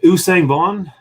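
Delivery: "Usang Vaughn" said as oo-SANG VAWN; pronunciation demo. Shown as a demonstration. Answering how the name is pronounced, "Usang Vaughn" as oo-sang vawn. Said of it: "how do you pronounce their name".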